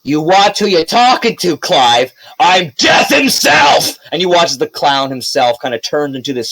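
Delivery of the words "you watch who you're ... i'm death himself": furious high threat; high, angry villain snarl; enraged villain. Acted. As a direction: roar it like Death himself. Villain clown threatens in rage.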